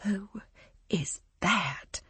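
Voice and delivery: unvoiced whisper. A narrator's reading. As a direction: lean in and whisper it. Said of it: "I lean over to Cass and whisper".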